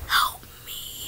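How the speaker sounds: faint whispered cry for help; barely voiced whisper; whispered plea. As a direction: whisper it weakly, pleading. Plea for help while playing.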